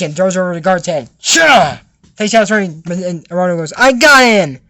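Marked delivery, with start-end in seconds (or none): 1.2-1.8 loud, higher interrupting yell; acted angry shout. Acted interruption of puppet show. 3.8-4.6 loud, raised call of triumph; acted excited shout. Acted capture of guard.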